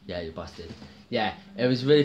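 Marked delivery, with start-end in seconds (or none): 0.1-0.7 much softer, muttered "you're busted"; quiet repeat. Acted. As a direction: say it again under your breath. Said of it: "I had to say that".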